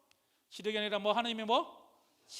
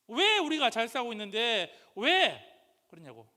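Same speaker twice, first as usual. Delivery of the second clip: loud, slow indignant protest that fades away; complaining; 3 semitones higher, 12 dB louder, slower than usual. Retold or imitated. Preacher voicing Israelites grumbling at Moses, loud slow indignant complaint. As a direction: demand why, aggrieved.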